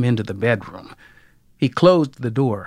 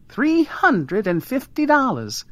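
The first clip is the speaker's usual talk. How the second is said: much higher, proud wife voice; 11 semitones higher than usual. A narrator's reading. Wife telling the visitor her savings, proudly. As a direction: announce the sum with pride, pitched high. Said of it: "Alma said proudly".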